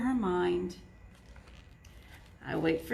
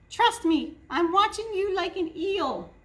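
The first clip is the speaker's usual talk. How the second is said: a hint of a sister watching closely; suspicious sister; 9 semitones higher than usual. A narrator's reading. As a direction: keep a sharp eye on him. Sister voiced warning.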